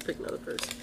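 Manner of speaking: whispered repeat, no voice. Real, not acted. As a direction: breathe it under your voice.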